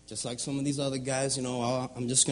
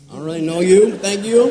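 louder and higher, joking; loud playful aside; 5 semitones higher, 14 dB louder than usual. Real, not acted. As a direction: toss it out to the crowd laughing.

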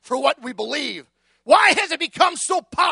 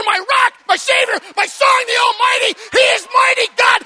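rising fervent preacher's litany, louder and higher as it builds; preaching at full force; 6 semitones higher, 6 dB louder than usual. Acted. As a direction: proclaim each name of Jesus like a crescendo. Preaching list of names of Jesus.

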